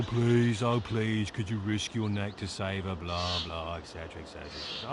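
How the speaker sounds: sing-song mock plea fading to a mutter; sarcastic imitation of a plea; mocking mimicry. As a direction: parody the pleading line, then trail off bored. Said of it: "and only she can defeat".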